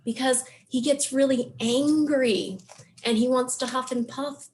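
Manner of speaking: normal talking voice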